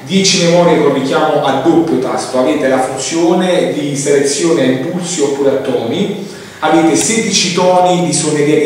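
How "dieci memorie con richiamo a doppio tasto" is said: ordinary delivery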